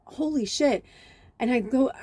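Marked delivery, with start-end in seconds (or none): 0.0-0.8 amazed. Retold or imitated. Both amazed at the view.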